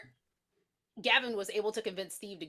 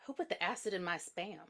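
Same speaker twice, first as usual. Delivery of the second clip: deadpan quote; 8 dB quieter than usual. Retold or imitated. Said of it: "And Rachel said".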